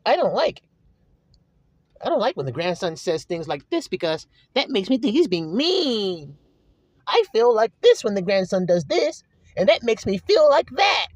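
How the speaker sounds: mock tearful whine, swinging high; hammed-up hurt griping; whining feelings. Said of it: "That's nothing but feelings".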